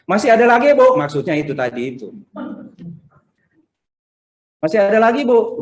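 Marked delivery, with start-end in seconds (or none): 0.0-1.0 imitated high, loud questioning call. Garbage collectors asking Ibu for more, loud call. 4.6-5.6 imitated high-pitched questioning call. Garbage collectors asking Ibu for more, high call.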